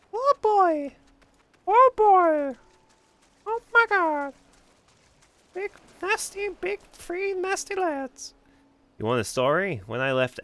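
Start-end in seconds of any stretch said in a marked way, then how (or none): 0.1-8.3 very high, loud cartoon yelp; dopey cartoon bellow. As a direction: squeal it like a cartoon character. Cartoon voice over NPC.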